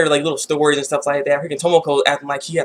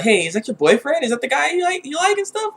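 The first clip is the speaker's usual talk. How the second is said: teasing cousin; 9 semitones higher than usual. Retold or imitated.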